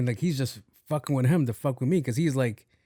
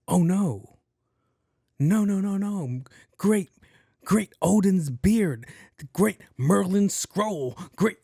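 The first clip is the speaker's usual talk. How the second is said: high, hammed-up wizardly dismay; in-character wizard gamer; 6 semitones higher, slower than usual. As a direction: wail grand oaths like a role-playing gamer. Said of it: "this guy really thinks he's really in character".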